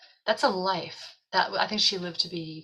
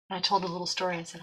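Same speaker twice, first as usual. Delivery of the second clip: ordinary talk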